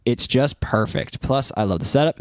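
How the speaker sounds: usual speaking voice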